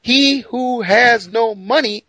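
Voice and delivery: slow, high, loud declaration; a prophet calling out to the poor, slow and high; slow proclaiming prophet. Retold or imitated. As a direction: call it out slowly to the whole crowd. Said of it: "And he says".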